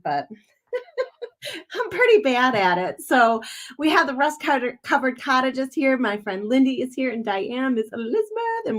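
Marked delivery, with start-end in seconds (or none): 0.7-3.0 high burst of laughter into the words; laughing at herself. Real, not acted. Streamer admits her Southern accent is bad, laughing. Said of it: "trying to work on my Southern accent".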